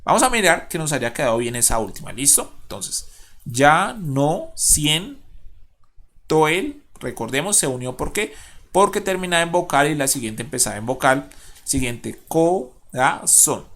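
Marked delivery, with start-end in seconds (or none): 3.4-6.8 "ya no siento el" slow, a pause after each syllable. Shown as a demonstration. Teacher checking the verse, "ya no siento el" slowly syllable by syllable. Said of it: "contemos de nuevo". 12.3-13.7 "corazón" slowly split as co, ra, zón; syllable by syllable. Shown as a demonstration. Teacher checking the last word, "corazón" in slow separate syllables. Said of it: "contemos de nuevo".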